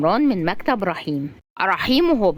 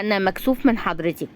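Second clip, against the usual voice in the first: usual speaking voice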